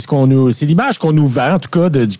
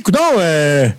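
put-on English accent; 11 semitones higher than usual. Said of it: "Sûrement avec, euh, l'accent anglais".

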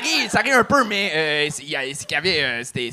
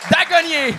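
host announcer; 13 semitones higher, 6 dB louder than usual. Acted. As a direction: stretch the name out like a game-show host.

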